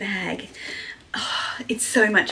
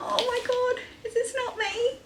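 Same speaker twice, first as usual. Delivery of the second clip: high, amazed question; high amazed is this not me; high delighted disbelief; 13 semitones higher, 3 dB quieter than usual. Real, not acted. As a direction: marvel at the likeness. Sees artwork of herself.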